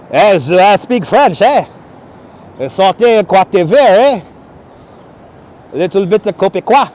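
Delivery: hammed-up, terrible French accent; generic French accent. Streamer jokes that he speaks French, generic French accent. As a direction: fake a bad French-Canadian accent with questioning "eh"s. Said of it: "my terribly, terribly, uh, generic French accent".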